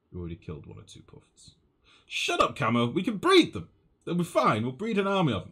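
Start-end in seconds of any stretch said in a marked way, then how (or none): 2.1-5.4 defiant. Real, not acted. Defending puffs against chat teasing.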